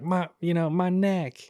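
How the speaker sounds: high-pitched mock plea; George Floyd. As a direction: put on a high pleading voice. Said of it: "where I do the George Floyd".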